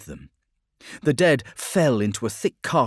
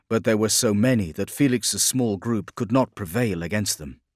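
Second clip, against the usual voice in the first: plain reading voice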